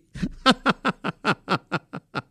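helpless chuckles dying away; laugh. Real, not acted. Uncle amused by niece's rudeness, fading laughter.

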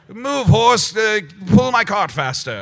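loud bossy grown-up driver; adult voice. Shown as a demonstration. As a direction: bark at the horse like an impatient adult. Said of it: "a full-grown adult driving a cart".

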